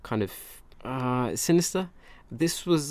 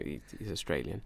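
ordinary talk, nothing marked

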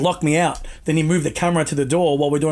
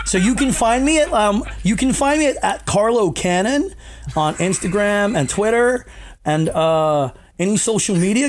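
mock Californian accent with rising phrases; American accent; 3 semitones higher than usual. Shown as a demonstration. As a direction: parody how Americans sound. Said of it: "I'm gonna say it in my American accent".

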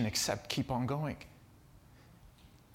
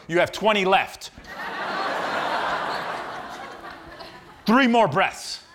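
coach order; 7 semitones higher, 6 dB louder than usual. Retold or imitated. Coach announces remaining reps.

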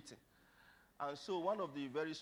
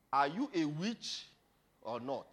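slow questioning; 6 dB louder than usual. Acted. Papa's question, first take.

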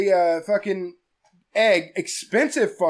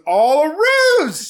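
loud, slow and higher, shouting out the twist; excited shout; 8 semitones higher, 6 dB louder, much slower than usual. Real, not acted. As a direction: shout it like a big reveal. Yelling the plot twist.

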